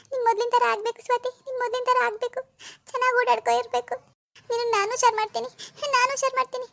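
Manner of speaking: mother crying to her daughter Swathi. Acted. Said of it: "ಅಳ್ಬೇಡ ಗಾಯತ್ರಿ".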